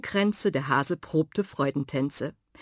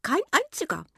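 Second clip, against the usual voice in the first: little hedgehog voice; 10 semitones higher, faster than usual. A narrator's reading. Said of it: "bestätigte der Igel".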